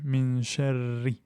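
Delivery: min sharri with a thin, light ra, drawn slowly. Shown as a demonstration. Teacher slowly demonstrating the light ra of sharri. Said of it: "Le ra est aminci".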